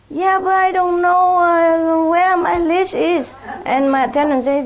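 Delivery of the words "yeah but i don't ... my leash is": innocent neighbor. Retold or imitated. Neighbor playing dumb about leash.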